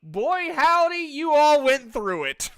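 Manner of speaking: high, loud folksy exclamation; boy howdy recap. Acted. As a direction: belt out boy howdy like a cowboy narrator. GM reading dramatic recap.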